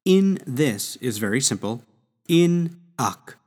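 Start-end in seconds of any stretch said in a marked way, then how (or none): 2.3-3.4 "In ac" said higher, with no H sound at the start; Latin with silent H. Shown as a demonstration. Teacher shows the class how to say hac without the H. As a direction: say "In ac", dropping the H of hac. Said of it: "we don't really emphasize the H".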